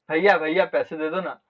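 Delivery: a sweet, mildly pleading ask; sweet coaxing plea. Retold or imitated. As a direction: wheedle lovingly for money like a little brother. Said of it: "बहुत प्यार से बोलूंगा".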